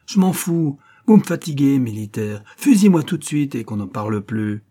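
high, loud burst that settles; defiant exasperation. Acted. Prisoner telling the officer to shoot her, fed-up defiance. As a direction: snap back, fed up and unafraid.

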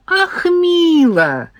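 high, drawn-out coo of joy; artist's high-pitched, lingering delight. A narrator's reading. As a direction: stretch it out with gushing delight. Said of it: "обрадовалась Зина".

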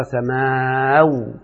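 سماو with long drawn-out aa ending in w; original final waw. Shown as a demonstration. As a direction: stretch سماو as "samaaa-w". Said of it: "تطرفت الواو".

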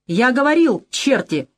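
abrupt rude reproach; sudden rough bark. A narrator's reading. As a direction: bark it coarsely down the phone. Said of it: "внезапно гаркнул Кукоцкий таким неожиданно грубым голосом".

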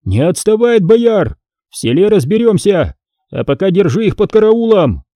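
high calling-out to soldiers; acted shouted orders. A narrator's reading. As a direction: call orders after the men. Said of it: "закричал литовцам".

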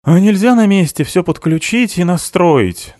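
loud, high-pitched complaint; grumbling. A narrator's reading. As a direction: whinge loudly about the job. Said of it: "ворчал Кройчик". (ворчливо, высоким голосом)

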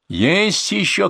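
high, drawn-out cry; shouting. A narrator's reading. Modeller demands whether others hide, crying out high. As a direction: demand an answer from afar. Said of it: "крикнул модельер".

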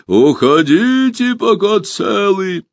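slow, drawn-out booming creature call; muffled monster voice. A narrator's reading. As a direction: loom over the forest with a hollow voice. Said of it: "глухой голос".

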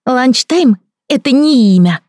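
put-on high, slow, serious child voice; stern little boy. A narrator's reading. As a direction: correct her like an indignant toddler. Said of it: "строго сказал он".

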